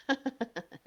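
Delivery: a short villain's cackle; evil laugh. Pretend. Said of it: "[name] hace risa malvada".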